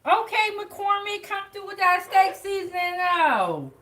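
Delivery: high, delighted inner voice praising the seasoning; mock impressed self. Cook to the seasoning brand, a high delighted mock squeal. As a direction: squeal it out like you're amazed. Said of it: "I was like".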